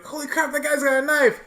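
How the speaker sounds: high mock panic; alarmed hero. Hero warning his chip about a knife, high alarm. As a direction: blurt it out in acted alarm. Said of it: "where he's like".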